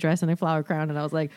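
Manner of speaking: ordinary talk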